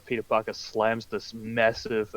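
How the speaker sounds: usual speaking voice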